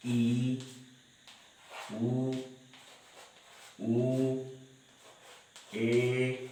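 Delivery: vowels drawn out one by one; slow teacher's roll-call of Hindi vowels; vowel recitation. Shown as a demonstration. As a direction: chant each vowel evenly, with long pauses between.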